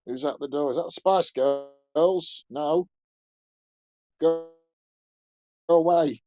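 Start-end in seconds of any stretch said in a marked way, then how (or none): 2.5-6.2 raised voice chasing a visitor off the doorstep; loud, fed-up go away; shooing away. Acted. Shooing someone from the door.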